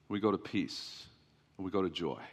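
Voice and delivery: preacher calming the church toward peace; hushed resolve. Acted. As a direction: let it land quietly. Soft lowered close.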